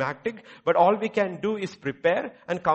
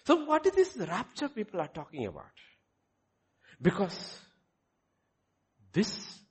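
ordinary delivery

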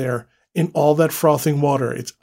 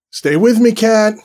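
loud, higher urgent plea; desperate plea; 8 semitones higher, 8 dB louder than usual. Acted. Husband begs his dying wife to stay with him, loud and desperate. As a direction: beg her to stay with you.

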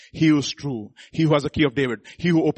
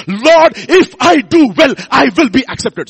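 louder and higher, slow and emphatic; said with conviction; 9 semitones higher, 10 dB louder, slower than usual. Shown as a demonstration. Preacher shows the congregation how, with conviction. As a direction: declare it with full conviction. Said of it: "I said with conviction".

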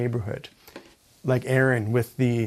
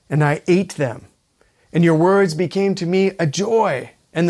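scripture prayer reading; 3 semitones higher, 4 dB louder than usual. Acted. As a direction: read the prayer out with conviction. Reads Jeremiah's prayer aloud.

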